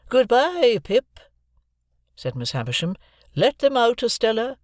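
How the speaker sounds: put-on grand, measured old lady handing over money; Miss Havisham's voice. A narrator's reading. Miss Havisham dismissing them.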